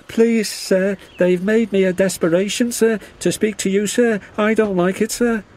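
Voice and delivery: very high, hurried squeaky voice; nervous little engine, pleading. A narrator's reading. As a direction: blurt it out nervously to the boss. Percy nervously pleads with Controller.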